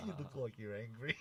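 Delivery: laughing quote. Retold or imitated. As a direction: laugh through the retold line. Retelling the accusation, laughing.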